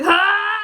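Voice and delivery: loud laughing cry of disgust; disgusted yelp. Retold or imitated. Touching the dog's tail, grossed-out laughing yelp. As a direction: shriek as you touch it. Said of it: "I'm like".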